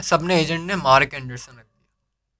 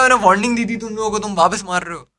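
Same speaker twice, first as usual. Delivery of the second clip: higher, fast, exasperated scolding as the boss; scolding manager; 5 semitones higher, 4 dB louder than usual. Retold or imitated. Manager scolds agents after his warning, annoyed higher fast telling-off.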